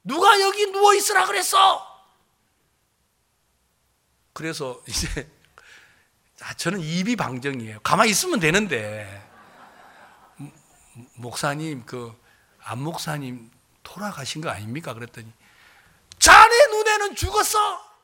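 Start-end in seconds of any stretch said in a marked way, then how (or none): 0.0-1.9 high-pitched, full-throated yell; shouting in full voice. Retold or imitated. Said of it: "막 완전히 막 고함을 치면서". 10.4-14.9 soft, low and halting; timid objection. Retold or imitated. Timidly corrects the shouting senior pastor.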